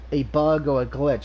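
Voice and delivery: ordinary talk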